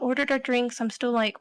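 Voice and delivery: ordinary talk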